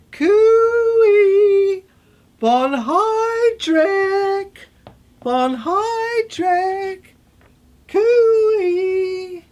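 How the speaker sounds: high, drawn-out call, like hailing someone across a field; loud, stretched coo-ee call; sing-song calling out. Acted.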